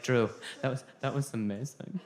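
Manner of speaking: soft therapist praise. Retold or imitated. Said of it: "They're like".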